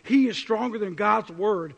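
usual speaking voice

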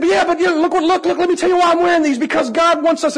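high, excitable cartoon goofball; silly high voice, words tumbling out; SpongeBob. Teacher explaining his underwear to kids, playing silly SpongeBob. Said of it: "I'm being SpongeBob".

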